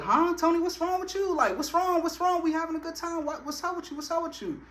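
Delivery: worried friends. Retold or imitated. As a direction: mimic the worried group. Friends asking what's wrong.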